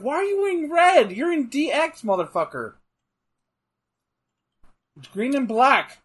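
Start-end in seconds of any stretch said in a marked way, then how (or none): 0.0-2.7 mock indignant. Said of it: "here he's like".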